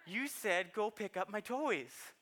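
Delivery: a hint of a kid reciting for dad; proud little boy. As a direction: say it like a toddler showing off. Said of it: "He's like".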